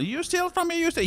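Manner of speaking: high-pitched mock outrage; angry waiter. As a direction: squawk it like an indignant waiter. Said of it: "He was, like, angry".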